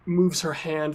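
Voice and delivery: ordinary delivery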